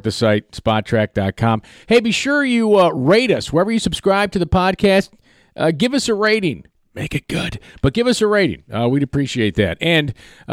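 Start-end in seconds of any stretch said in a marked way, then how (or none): 1.9-8.6 announcer. Acted. Podcast outro plug.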